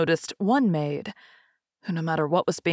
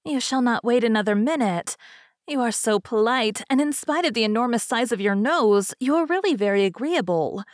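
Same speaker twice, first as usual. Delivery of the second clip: higher-pitched, pleased fairy hostess; flattered fairy; 4 semitones higher than usual. Acted. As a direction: gush a little as a flattered fairy. Flattered fairy calls to her servants.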